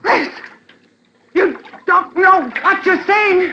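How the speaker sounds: wife begging her husband in the water, panicking; panicked plea. Acted. High slow frightened cry.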